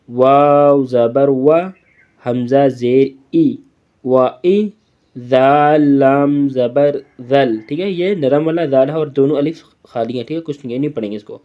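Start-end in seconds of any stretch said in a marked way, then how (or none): 0.1-7.6 slow, drawn-out letter-by-letter spelling. Shown as a demonstration. Teacher spells the word slowly for the children. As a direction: chant each letter and vowel slowly, like a primer drill. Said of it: "اس کا آپ نے دھیان سے پڑھنا ہے".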